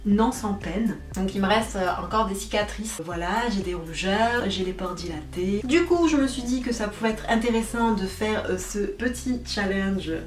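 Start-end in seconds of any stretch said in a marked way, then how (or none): none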